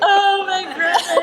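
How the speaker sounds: high, loud, slow wail; half-laughing retold wail. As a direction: cry out in grief. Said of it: "And then I'd be like".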